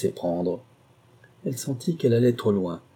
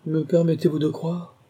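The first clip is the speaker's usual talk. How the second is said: higher-pitched mock politeness; 7 semitones higher than usual. A narrator's reading. Husband doubting his sleepless wife, mocking. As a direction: ask it as a mocking question. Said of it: "fit-il d'une voix moqueuse".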